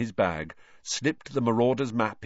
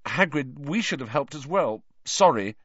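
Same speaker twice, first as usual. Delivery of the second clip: awkward; 5 semitones higher, 4 dB louder than usual. A narrator's reading. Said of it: "Harry began awkwardly".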